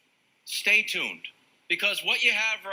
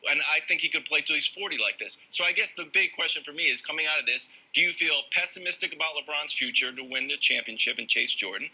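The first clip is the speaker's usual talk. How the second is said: ordinary talk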